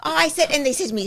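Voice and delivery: usual speaking voice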